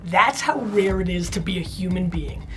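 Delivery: low, hushed, urgent pep talk; hushed urgent pleading; intense motivational speech. Acted. Hushed motivational speech over music.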